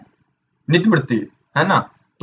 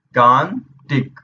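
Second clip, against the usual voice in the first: drawn-out pronunciation; much slower than usual. Shown as a demonstration. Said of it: "जो हम लोग उच्चारण करते हैं वही लिखते हैं".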